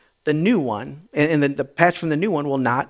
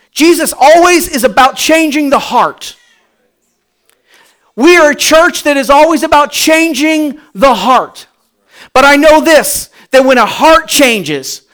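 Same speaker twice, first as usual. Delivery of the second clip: full-force preaching; 7 semitones higher, 7 dB louder than usual. Acted. Pastor declaring the church's mission, louder preaching. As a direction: proclaim it to the whole church.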